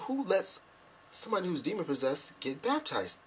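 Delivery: high, astonished rhetorical question; incredulous. Retold or imitated. Speaker reacting to the baptism, high incredulity. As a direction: push the pitch up, appalled.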